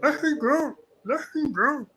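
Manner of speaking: high, loud goblin demand; small attacking goblin. Acted. Goblin hitting you on your hips, urgent high demand. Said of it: "little hands start hitting you".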